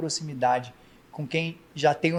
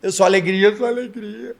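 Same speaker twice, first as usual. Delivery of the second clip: joyful; 6 semitones higher, 6 dB louder than usual. Shown as a demonstration. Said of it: "falar com o sentido de cada palavra".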